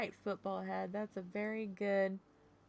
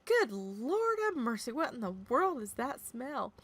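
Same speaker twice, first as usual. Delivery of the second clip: disgusted shock; 5 semitones higher, 3 dB louder than usual. Retold or imitated.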